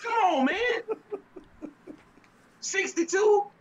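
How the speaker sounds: high, slow, half-crying laugh of disbelief; can't believe the score, laughing. Real, not acted.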